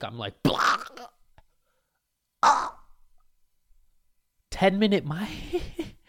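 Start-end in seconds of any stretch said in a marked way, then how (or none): 0.4-2.8 panicked yelp. Retold or imitated. Panics at ten-minute mic news. 4.5-6.0 high, slow, stretched disbelieving squeak; shocked disbelief. Retold or imitated. Comic hearing of a ten-minute mic, high slow disbelief.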